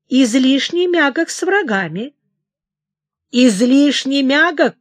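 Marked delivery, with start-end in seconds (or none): 3.3-4.8 loud slow indignant outburst; angry shout. A narrator's reading. King hurls his mother's words back, angry shout. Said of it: "крикнул он".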